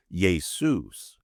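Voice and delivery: Jesus as yay-SOOS; Greek Jesus. Shown as a demonstration. Host voicing Jesus's own answer: Jesus as yay-SOOS. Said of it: "what his name was".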